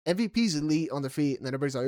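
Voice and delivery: normal talking voice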